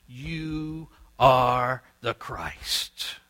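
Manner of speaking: very slow, drawn-out declaration; declaring loudly. Acted. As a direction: proclaim it word by word, stretched out. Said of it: "declaring loudly".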